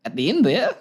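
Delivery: "at the end there" lifting into a question; question-like Kiwi upturn on "there", laughing after; inquisitive Kiwi rise. Shown as a demonstration. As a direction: let "there" swing up inquisitively, amused. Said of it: "any Kiwi accent, we're always just a little bit, uh, inquisitive".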